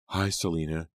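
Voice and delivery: usual speaking voice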